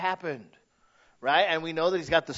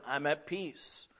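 soft, slow, much quieter; quiet calm reply; 3 semitones lower, 9 dB quieter, slower than usual. Retold or imitated. Someone asked how they are, replying softly and slowly. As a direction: answer gently and slowly, settled. Voicing a content person's reply.

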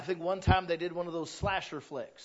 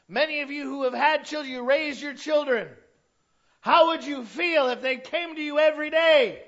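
loud, raised preacher's challenge; full-force preaching; 11 semitones higher, 11 dB louder than usual. Real, not acted. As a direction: drive the question home to the congregation, loudly.

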